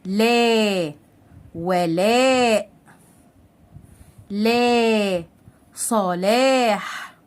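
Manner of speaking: slowly stretched aa in "لا", "ولاء", "صلاح"; long alif madd. Shown as a demonstration. Teacher modelling long-vowel words, drawn-out "لا", "ولاء", "صلاح". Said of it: "نقرأ كلمات بالحركات الطويلة".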